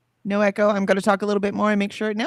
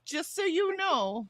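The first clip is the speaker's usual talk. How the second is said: mock parental tone; 10 semitones higher, 3 dB louder, slower than usual. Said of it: "it's like".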